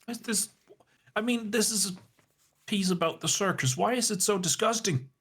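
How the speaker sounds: high, appalled reaction; high disgusted character voice. Acted. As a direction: squeal of disgust.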